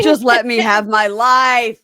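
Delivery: loud, slow melodramatic wail; mock drawn-out yell. Imitating her demanding her freedom, yelling melodramatically. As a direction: belt it out, stretching every word. Acted yelled quote from quarrel.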